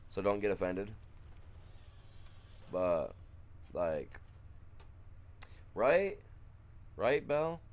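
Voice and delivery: ordinary talk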